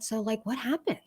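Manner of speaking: very soft, gentle asking; calm question. Retold or imitated. Mom asks her daughter what happened, calmly. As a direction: ask quietly, holding the anger back. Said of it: "I just calmly said".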